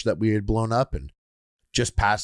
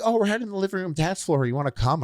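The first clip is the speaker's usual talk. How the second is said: partiers' invitation; 9 semitones higher, faster than usual. Retold or imitated. Voicing friends inviting him along.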